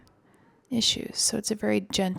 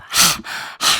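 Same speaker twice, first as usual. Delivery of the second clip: forceful panting out over the tongue; loud rapid huffing breaths, tongue out; lion's breath; 7 dB louder than usual. Shown as a demonstration. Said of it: "Lion's breath".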